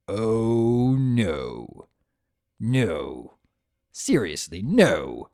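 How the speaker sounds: low, growing groans of refusal; deep voice sinking in disbelief; dismayed Discord. Acted. Trapped Discord groaning at his new keeper, low and appalled.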